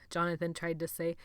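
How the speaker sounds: ordinary delivery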